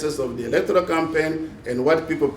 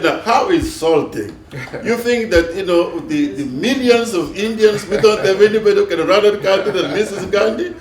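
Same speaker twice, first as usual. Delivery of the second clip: retold indignation, higher and louder; mock outrage; 5 semitones higher, 5 dB louder than usual. Offended friend answers a joke about Gandhi, retold with mock outrage. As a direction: act out an affronted friend, amused. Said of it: "He almost had a heart attack".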